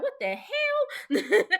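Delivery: very high, loud, drawn-out disbelief; high-pitched baffled outburst; mock shock. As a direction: squeal it incredulously, breaking into laughter. Mock shock at the script flip.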